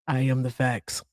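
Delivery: lightly mock Hector's deadpan; a hint of Hector stating the facts; imitating Hector. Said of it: "That's how Hector talks".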